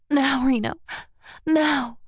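high urgent breathless plea; urgent pleading. A narrator's reading. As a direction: beg it, rising and urgent. Heroine urging at climax.

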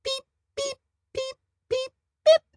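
tiny high squeaky beeps; beeping chicks. Acted. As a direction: beep like baby birds. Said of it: "said the pea chicks".

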